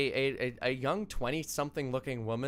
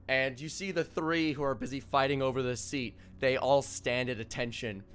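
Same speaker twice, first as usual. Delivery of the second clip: ordinary delivery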